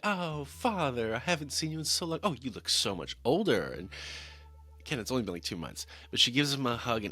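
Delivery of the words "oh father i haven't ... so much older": play-acted daughterly fawning; gushing mock greeting. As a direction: gush over him in a put-on sweet greeting.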